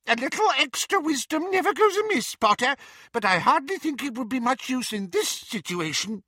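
very high squeaky little voice; squeaky Flitwick. A narrator's reading. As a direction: squeak it like a tiny old wizard. Flitwick voice held.

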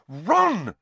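high, loud reproach; scolding cry; sharp. A narrator's reading. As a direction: cut in sharply on the name. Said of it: "said Hermione sharply".

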